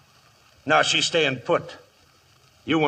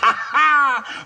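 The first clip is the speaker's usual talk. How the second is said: short, loud, mocking laugh; gloating laugh; 7 semitones higher, 4 dB louder than usual. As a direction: laugh at the trapped lawmen. Outlaw gloats in the house.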